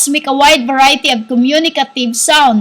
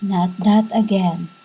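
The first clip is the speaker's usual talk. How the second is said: low, soft spirit voice; spirit like flowing water; 7 semitones lower, 6 dB quieter than usual. Shown as a demonstration. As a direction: let it flow softly and low, like a stream. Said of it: "like a stream of water, we talk like".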